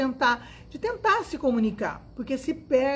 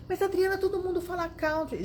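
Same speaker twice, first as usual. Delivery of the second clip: student objecting; 7 semitones higher than usual. Pretend. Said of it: "imagined student objecting".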